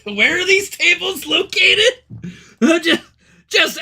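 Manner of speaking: high, loud question broken by laughter; laughing. Real, not acted. Streamer joking to chat about milking tables, laughing. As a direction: ask it while cracking up.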